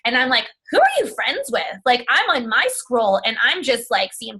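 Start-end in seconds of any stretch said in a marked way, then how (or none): 0.7-1.6 high-pitched, disbelieving question; incredulous retort. Retold or imitated. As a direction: challenge them in playful disbelief. Mock-disbelieving reply to retold complainers.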